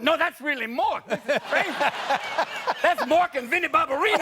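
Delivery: high, loud excited chatter; thrilled wedding guests spotting stars. Retold or imitated. Imitating wedding guests recognising Mork, high and excited. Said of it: "they're going".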